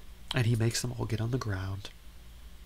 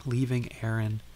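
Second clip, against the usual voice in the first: ordinary talk, nothing marked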